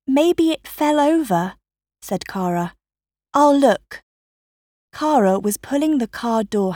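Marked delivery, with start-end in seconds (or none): 0.1-1.6 put-on high-pitched girl's suggestion; young girl's voice. A narrator's reading. Daughter suggests, voiced in story. 3.3-4.0 put-on high, loud girl's voice; eager girl. A narrator's reading. Daughter offers to look.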